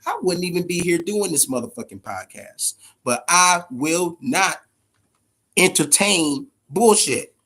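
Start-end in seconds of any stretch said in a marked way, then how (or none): 3.0-7.3 each word slow and firm; slow emphatic. Real, not acted. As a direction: lay it down slowly, no nonsense.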